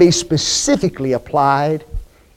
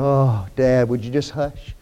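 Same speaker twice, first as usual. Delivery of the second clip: low, softer eye-rolling complaint; understated mock teenage-style exasperation; exasperated kid; 7 semitones lower, 6 dB quieter than usual. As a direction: mildly voice a kid fed up with Dad. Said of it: "the kid's sitting there rolling his eyes going".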